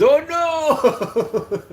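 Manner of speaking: very high squealing goat cry; hammed-up goat in panic; scared goat. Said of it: "the goat says".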